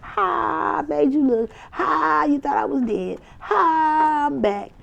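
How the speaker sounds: very high, cackling "ha, made you look"; hammed-up triumphant mock cackle; gloating taunt. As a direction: crow over them, back from the dead. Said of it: "You like".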